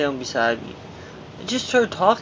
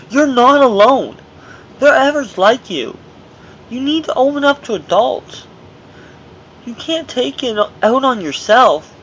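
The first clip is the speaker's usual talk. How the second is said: staff lecturing; 8 semitones higher, 5 dB louder than usual. Retold or imitated. Ward staff repeating advice to a scared boy, mock lecturing. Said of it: "they kept going on, telling me".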